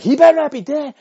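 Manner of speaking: high, loud mock shout; imitating the wife. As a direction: yell it like the wife at the scene. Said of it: "she was like".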